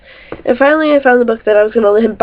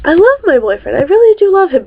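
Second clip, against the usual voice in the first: ordinary talk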